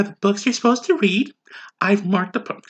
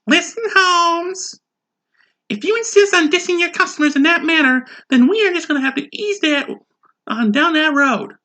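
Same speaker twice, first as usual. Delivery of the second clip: higher, hammed-up street slang; mock street talk; 9 semitones higher than usual. Customer scolding the waiter, hammed-up street talk. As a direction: scold him like a goofy homeboy.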